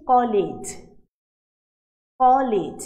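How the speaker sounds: কলেজ said loud and slow, the stop clearly caught; emphatic demo word. Shown as a demonstration. Teacher demonstrates a two-syllable word to students, slow and loud. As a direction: pronounce কলেজ slowly and loudly so the break shows. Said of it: "বাকযন্ত্রে কিন্তু এক জায়গায় একটু বাধা পাচ্ছে".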